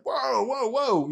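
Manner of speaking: high, drawn-out retold whoas; alarmed. Pilot as the left pedal is shoved, alarmed. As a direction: imitate a pilot losing control, pitch jumping up.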